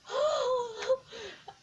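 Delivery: gasp. Real, not acted. Finally finding the lost slides.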